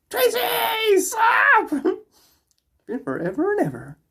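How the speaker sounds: high-pitched squealed greeting; excited shout. Real, not acted. Greets viewer arriving in chat.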